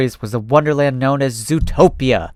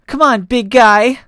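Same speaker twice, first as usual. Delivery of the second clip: much higher, louder, slow; coaxing cry; 9 semitones higher, 6 dB louder, slower than usual. Acted. Critter urging the cheetah into the photo, higher and louder. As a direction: coax him in. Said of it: "cried Telephone".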